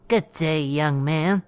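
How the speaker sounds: lightly voiced old woman greeting; old lady. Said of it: "An old lady greeted me".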